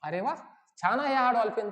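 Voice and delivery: ordinary talk, nothing marked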